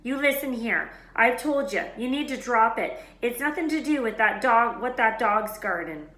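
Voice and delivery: loud, firm telling-off; stern warning. A narrator's reading. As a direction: warn them off firmly. Hagrid warns them sternly.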